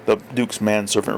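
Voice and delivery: ordinary delivery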